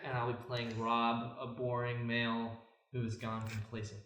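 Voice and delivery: usual speaking voice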